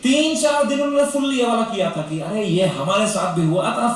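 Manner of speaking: ordinary delivery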